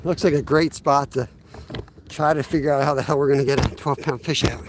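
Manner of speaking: out of breath; winded after a run; heavy breathing. Real, not acted. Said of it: "I'm sorry I'm breathing hard".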